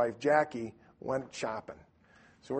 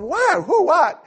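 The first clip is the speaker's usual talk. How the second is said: loud, high-pitched mock bewilderment; startled panic; 13 dB louder, slower than usual. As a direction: yelp the questions frantically. Startled husband asks back.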